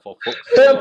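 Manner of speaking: hammed-up for fuck's sake; exasperated inner voice. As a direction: throw it up high in mock despair. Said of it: "just thought".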